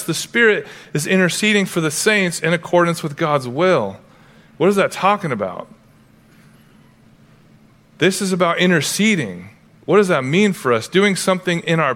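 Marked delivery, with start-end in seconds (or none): none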